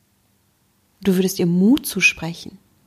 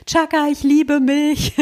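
higher, hammed-up affirmation cheer; mock cheer; 7 semitones higher, slower than usual. Coach mocking a forced self-love cheer at the mirror, higher. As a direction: overdo it like a forced pep chant. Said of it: "in dem Moment zu schreien".

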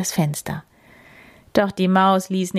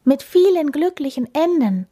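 high, happy lilt; delighted; 9 semitones higher, 4 dB louder than usual. A narrator's reading. Hedgehog hoping for happy endings, delighted. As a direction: rejoice with pitch well up. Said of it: "freute sich der Igel".

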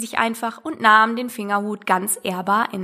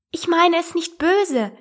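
higher, slow, pleading apology; apologising; 7 semitones higher than usual. A narrator's reading. Poor Alice placating the offended mouse, apologetically. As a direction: plead gently in a raised voice. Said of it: "entschuldigte sich die arme Alice".

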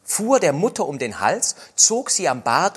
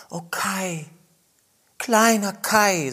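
sighing; 7 semitones higher, slower than usual. Acted. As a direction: sigh his name sadly. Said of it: "seufzte Gerda".

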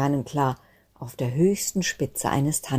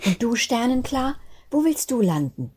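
higher, lightly voiced curious child; sibling asking; 8 semitones higher than usual. A sibling asking Sternenklar where she will land, lightly voiced. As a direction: ask her sweetly.

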